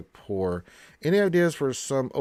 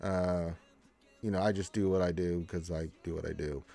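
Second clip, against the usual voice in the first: ordinary talk, nothing marked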